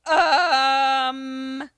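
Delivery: loud, high, held-out hesitation sound; long awkward "um". Acted. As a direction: stretch an exaggerated, stunned "ummm". Retold stunned reaction to stranger.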